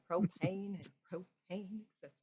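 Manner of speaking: Hank Hill. Acted. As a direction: drag it out in a small-town Texas accent. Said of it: "Full Hank Hill".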